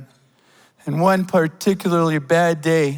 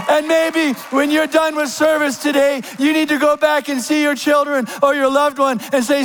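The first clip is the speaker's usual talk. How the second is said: fervent preaching; 9 semitones higher than usual. Acted. Preaching with full force.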